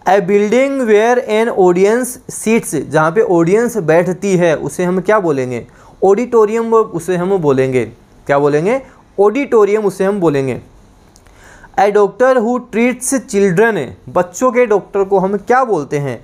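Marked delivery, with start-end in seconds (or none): 0.1-2.8 very slow, each English word spaced for note-taking; slow dictation. Shown as a demonstration. Teacher dictating to students, very slow and spaced. 11.8-13.8 very slow, each English word spaced for note-taking; slow dictation. Shown as a demonstration. Teacher dictating to students, very slow and spaced.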